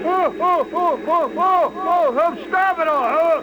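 high, loud, anguished oh's; panicked cries of stop it all; nightmare wail. Acted. Nightmare of the tap-dancing xylophone.

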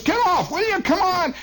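high-pitched yelling driver; bus driver yelling. Retold or imitated. Driver yelling at the man on the bus front. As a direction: yank the rider off, urgently. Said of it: "And our driver says".

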